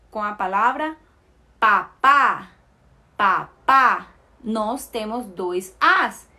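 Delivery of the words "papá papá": papá with a louder, stressed final á; pa-PÁ, each syllable set apart; stress on second syllable. Shown as a demonstration. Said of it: "tem um som mais forte".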